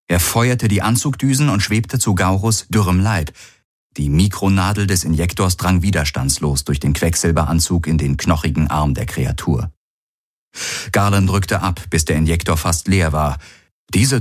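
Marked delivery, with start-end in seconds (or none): none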